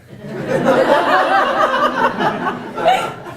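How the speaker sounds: big high burst of laughter; rising, delighted laugh; hearty laugh. Real, not acted. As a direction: laugh out loud at yourself. Big laugh at own story.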